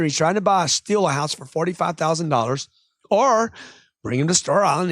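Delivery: usual speaking voice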